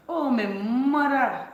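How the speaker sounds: loud, slow, drawn-out dialect "Oh, my mother"; Caribbean dialect, OH stretched long and high; dialect exclamation. Shown as a demonstration. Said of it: "Oh, my mother is one for real".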